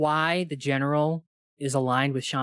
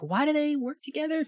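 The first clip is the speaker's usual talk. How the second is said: exasperated question; 12 semitones higher than usual. Retold or imitated. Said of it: "I was just like".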